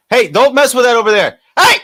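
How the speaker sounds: loud, high warning shout; calling out. Real, not acted. Shouting a warning at someone nearby, loud and high. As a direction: yell across to stop someone touching it.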